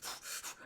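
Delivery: a sharp voiceless gasp; mock gasp. Acting out her frustration for her cohost, with a sharp voiceless gasp. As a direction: catch your breath in fake frustration.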